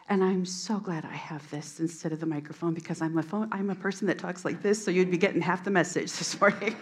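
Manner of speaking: ordinary talk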